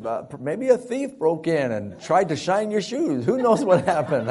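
high, loud, scrambling alibi; mock panicked excuses. Boy lying to his dad, mock panicky excuses. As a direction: babble an excuse like a cornered kid.

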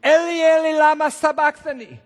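high, loud, desperate cry; piercing anguished shout; scream in the dark. Acted. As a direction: cry out to God with full force. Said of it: "This is a scream in the dark".

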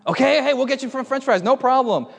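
high-pitched, eager reassurance; hasty placating. Retold or imitated. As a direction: rush to calm an upset kid. Hastily placates the crying child.

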